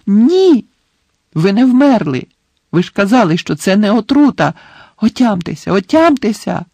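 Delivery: high, loud, panicked denial; frantic shouting. A narrator's reading. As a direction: desperately try to shout him awake. Harry frantically shakes collapsed Dumbledore.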